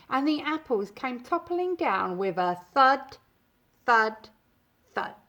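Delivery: plain reading voice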